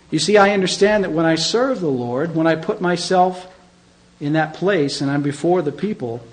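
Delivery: usual speaking voice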